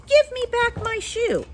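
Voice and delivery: put-on high, loud angry child; Dorothy shouting for her shoe back; angry demand. Angry girl demands shoe from witch, high and loud. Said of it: "grew angry and said to the witch".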